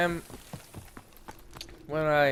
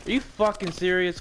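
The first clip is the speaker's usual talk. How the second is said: higher-pitched outraged disbelief; real exasperated outburst; 6 semitones higher than usual. Real, not acted. Player cursing at the game mid-story, exasperated. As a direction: protest it, pitch jumping up.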